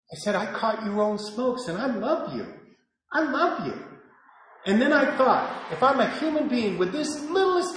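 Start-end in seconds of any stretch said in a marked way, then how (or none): 0.3-3.8 softer, tender reassurance; soft fatherly forgiveness; loving. Retold or imitated. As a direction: say it gently, with love. Contrasted with the tirade.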